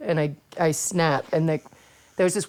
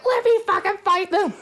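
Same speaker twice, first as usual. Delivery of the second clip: shouting from a locked car; retold yell; 14 semitones higher than usual. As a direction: demand to be let out at full volume. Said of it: "I was like".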